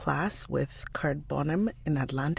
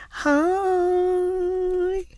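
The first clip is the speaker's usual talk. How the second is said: high, loud sing-song hello; bright greeting; 14 semitones higher, 4 dB louder than usual. Real, not acted. Vlogger greets a viewer in chat, delighted. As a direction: greet her like a favorite friend.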